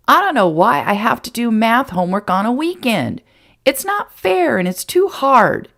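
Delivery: put-on high, sulky boy's complaint; pouting child griping about homework; whiny boy. A narrator's reading. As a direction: whine it like a put-upon kid.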